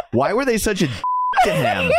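high question broken by laughter; laughing mock question. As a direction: squeak it out mid-laugh. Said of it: "are like".

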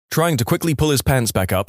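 normal talking voice

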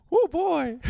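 very high, loud falsetto. Pretend. Playing along with the co-host's bit, high falsetto. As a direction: squeal it in a put-on cutesy voice.

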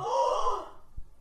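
high shocked gasp; gasping at the story. Real, not acted. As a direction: suck in a sharp gasp.

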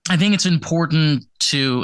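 usual speaking voice

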